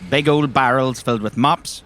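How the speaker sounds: ordinary delivery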